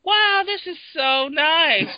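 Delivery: mock the tourists who marvel at everything; mock gushing tourist. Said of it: "They go".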